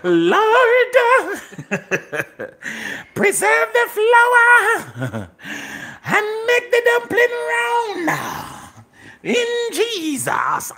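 very high, drawn-out shouted plea; slow, playful wail to the heavens; mock fervent prayer. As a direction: holler it like a revival preacher, hammed up. Mock prayer over the dumplings.

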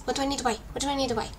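normal talking voice

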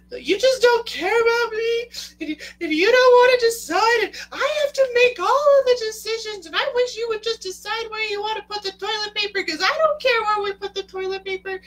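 very high, loud hysterical rant; mock wife meltdown. Wife blowing up at husband over decisions, shrieking mock tantrum. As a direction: shriek it all out in one exaggerated tirade.

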